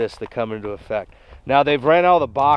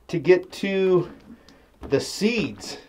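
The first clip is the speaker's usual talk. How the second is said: usual speaking voice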